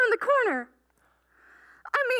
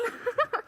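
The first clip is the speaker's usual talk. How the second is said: happy giggle; 5 semitones higher, 4 dB quieter than usual. Acted. Happy laugh after yes.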